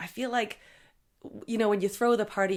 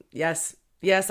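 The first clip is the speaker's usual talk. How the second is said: ordinary talk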